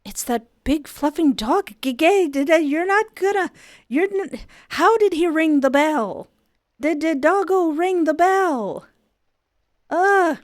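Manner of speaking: high, loud babbling; flustered panic. Real, not acted. Player panicking over the doorbell-ringing dog, flustered. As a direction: tumble over your words.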